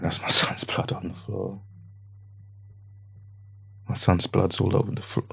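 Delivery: hushed, breathless disbelief; stunned whisper. Retold or imitated. Father seeing his son's blood, whispering in shock. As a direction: whisper it as if you can't believe your eyes.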